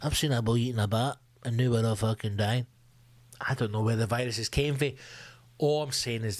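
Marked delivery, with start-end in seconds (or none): 0.0-2.7 conspiracy bloke. Retold or imitated. Comic mocking people blaming bat-eaters, in a low put-on voice. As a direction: lower put-on voice of a gossiping bloke. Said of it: "people have started things like".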